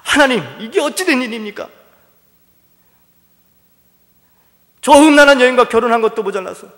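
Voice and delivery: crying out loud. Acted. Hosea cries out to God, demanding a divorce, loud and desperate. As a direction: cry out to God, voice straining high. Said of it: "더 큰 목소리로 막 부르짖으면서".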